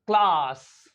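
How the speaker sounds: CLASS with a drawn-out open 'ah'; British long A. Shown as a demonstration. Teacher repeating the British word for learners, long-ah CLASS. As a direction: open the mouth wide for class, lips unrounded. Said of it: "in British English".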